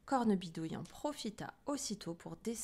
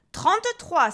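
much higher, loud burst; loud, irritated order; exasperated doctor; 10 semitones higher, 14 dB louder, slower than usual. A narrator's reading. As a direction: bark it out, losing patience. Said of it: "s'énerva le docteur".

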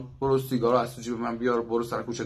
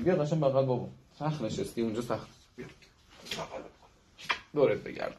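soft coaxing to dog; 6 dB quieter than usual. Real, not acted. Trainer coaxing his dog to come to him, soft and tender. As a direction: coax the dog gently into your arms.

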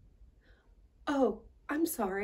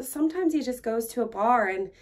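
ordinary talk, nothing marked

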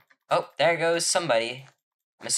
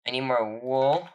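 normal talking voice

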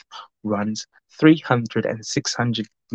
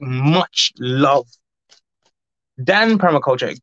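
ordinary delivery

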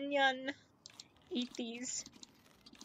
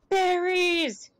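gasp, then blurt Berries out louder and higher; delighted gasp; 5 semitones higher, 6 dB louder than usual. Real, not acted. Streamer spots berries, delighted gasping exclaim. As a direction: spot treasure and burst out.

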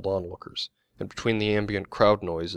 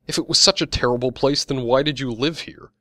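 higher, louder angry challenge; indignant demand; 6 semitones higher, 7 dB louder than usual. A narrator's reading. Young woman challenging the man who disparaged the place, indignant demand. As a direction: demand an answer, voice raised. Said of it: "demanded a short young woman".

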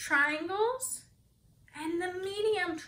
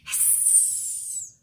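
long unvoiced hiss; snake hiss; 10 dB quieter than usual. Shown as a demonstration. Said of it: "hiss again like a snake".

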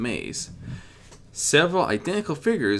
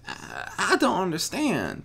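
worried mutter; 9 semitones higher than usual. A narrator's reading. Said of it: "she muttered worriedly".

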